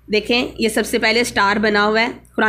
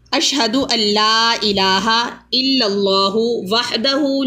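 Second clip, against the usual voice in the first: slow, measured recitation of a dua; slow prayerful Arabic recitation; much slower than usual. Acted. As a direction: recite it slowly and devoutly, word by word.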